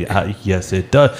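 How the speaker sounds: low, soft sarcastic reply; understated sarcasm, starting under the breath. Retold or imitated. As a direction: imitate a dryly sarcastic chef. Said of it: "answers him very, very sarcastically".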